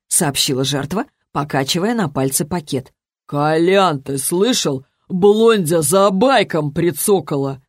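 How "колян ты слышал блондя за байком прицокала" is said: drawn-out jeering lout; mocking drunk thug. A narrator's reading. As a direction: share the joke with a drunk mate. Drunk thug mocking to his pal. (развязная пьяная насмешка)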